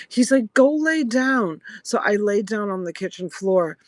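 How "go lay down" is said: high, louder, drawn-out command; retold impatient command, stretched out; imitated exasperated order. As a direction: act out a husband ordering his wife.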